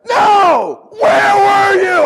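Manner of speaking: hammed-up panicked parent yell; frantic shout. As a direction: scream it at the late teen.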